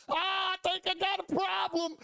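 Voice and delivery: very high, strained-up mock wail; injured rider's alarm. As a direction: imitate a hurt friend calling out in alarm. Retold injured rider realising his injury.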